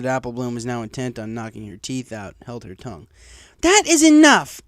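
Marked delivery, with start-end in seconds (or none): 3.6-4.6 loud slow commanding shout; stern shout. Acted. Teacher silences the class, stern loud shout. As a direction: stop the whole class dead. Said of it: "Cheerilee shouted sternly".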